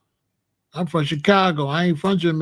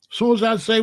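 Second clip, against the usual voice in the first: ordinary talk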